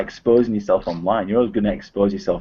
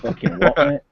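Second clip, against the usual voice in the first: British "what, mate", pitched up on "what"; British accent; 3 semitones higher than usual. Shown as a demonstration. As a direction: toss out a British challenge, rising on "what". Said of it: "in the British accent".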